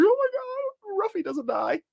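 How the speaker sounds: very high, slow, choked-up squeal; mock tearful gush. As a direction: draw it out like you're about to cry. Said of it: "I'm like".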